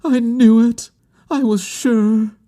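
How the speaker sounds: very high cry of triumph and pain; exulting, pained cry. A narrator's reading. Woman hearing it was her name, high exultant cry. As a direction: cry it out high, drawing out the end. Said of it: "an exulting and terrible cry".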